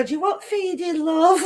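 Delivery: husband baby-talking his cats, played up high; imitating husband with cats. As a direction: mimic the husband cooing at the cats. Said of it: "and he'll go".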